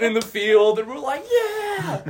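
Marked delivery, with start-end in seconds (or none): 1.3-2.0 high whoop; celebrating yell; victory cheer. Retold or imitated. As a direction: cheer like you just won. High-fiving in the field.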